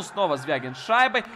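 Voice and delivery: ordinary talk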